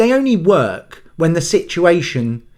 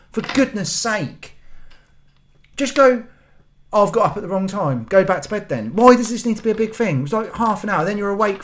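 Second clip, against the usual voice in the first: exasperated scolding; 6 semitones higher than usual. Retold or imitated. Scolding daughter at three am.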